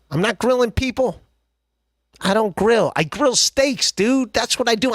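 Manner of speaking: high, indignant protest; defensive outburst. Real, not acted. Defensive rant.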